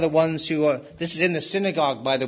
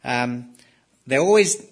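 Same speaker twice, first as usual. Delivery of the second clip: ordinary talk, nothing marked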